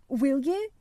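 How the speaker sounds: put-on excited boy asking; eager cry. A narrator's reading. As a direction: press him hopefully, voice lifting. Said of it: "he cried".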